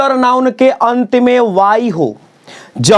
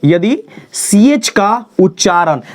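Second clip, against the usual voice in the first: slow emphasis; slower than usual. Shown as a demonstration. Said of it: "ध्यान से".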